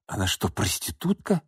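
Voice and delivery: hushed horrified gasp. Acted. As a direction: whisper it in horror. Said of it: "ужаснулся Руслан".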